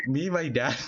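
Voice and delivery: ordinary talk, nothing marked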